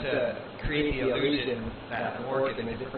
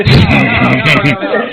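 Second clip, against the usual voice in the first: laughing loud no no no; laughing protest; 20 dB louder, slower than usual. Real, not acted. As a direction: cut them off through laughter. Laughing, rejecting the mock orc line.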